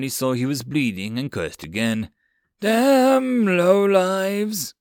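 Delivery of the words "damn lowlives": long drawn-out, loud high yell; screamed curse. A narrator's reading. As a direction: scream it out, stretching each word. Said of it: "Dante screamed".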